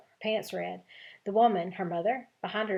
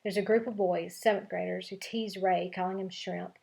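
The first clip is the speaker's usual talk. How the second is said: ordinary delivery, nothing marked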